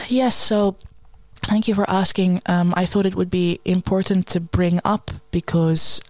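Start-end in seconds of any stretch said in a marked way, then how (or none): none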